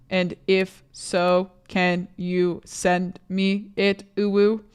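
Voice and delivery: very slow, evenly spaced words; typing aloud. Real, not acted. Streamer texting a friend for a photo, slow word-by-word dictation. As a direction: sound out each word as you type it.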